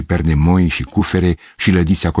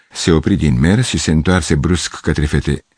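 usual speaking voice

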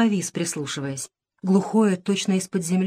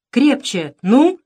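louder, higher push to a final heave; urging on; 5 semitones higher, 6 dB louder, much slower than usual. A narrator's reading. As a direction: drive helpers to push harder. Grandfather urges a heave. (подгоняет, с усилием)

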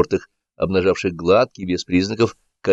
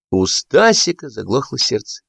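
ordinary delivery

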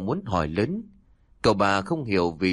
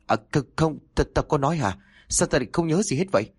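higher, fast, stammering; flustered denial; 7 semitones higher, faster than usual. A narrator's reading. Guilty brother caught out, stammering fast and high. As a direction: fumble to deny it.

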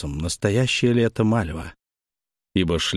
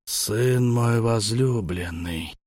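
put-on slow, creaky old man's voice; 3 semitones higher, much slower than usual. A narrator's reading. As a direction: drag each word out like a frail old man. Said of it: "проскрипел старик". (дряхлый старик, скрипуче и медленно)

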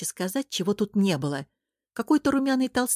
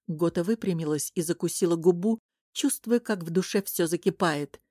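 ordinary delivery

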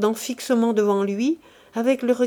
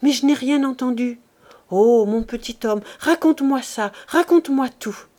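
eager coaxing; 4 semitones higher, 5 dB louder than usual. Acted. Wife begs husband for news.

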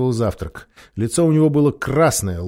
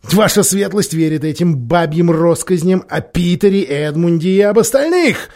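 loud, higher mocking retort; scornful king; 7 semitones higher, 7 dB louder than usual. A narrator's reading. Miraz deriding Glozelle for believing nursery tales, loud scorn. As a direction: sneer at the old tales.

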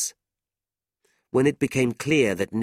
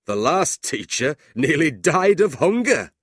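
higher, louder hearty jest; amused big man; 7 semitones higher, 6 dB louder than usual. A narrator's reading. John joking to the new teacher about hunger, amused. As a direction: crow it as a hearty joke. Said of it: "John laughed".

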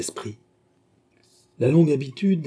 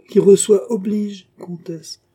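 noble pronouncement, higher, louder and slow; nobly; 9 semitones higher, 8 dB louder, slower than usual. A narrator's reading. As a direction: declaim it with princely dignity. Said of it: "dit noblement le prince". (avec noblesse, plus haut, plus fort et lentement)

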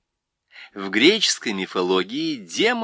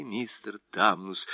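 usual speaking voice

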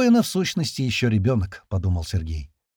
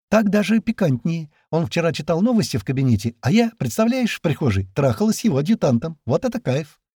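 put-on higher-pitched, animated girlish chatter; gleeful gossip; 6 semitones higher than usual. A narrator's reading. Girl boasting to Sergei about an affair, gleeful. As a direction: dish the scandal with relish.

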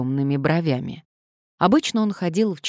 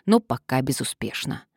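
usual speaking voice

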